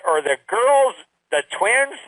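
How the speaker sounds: ordinary talk, nothing marked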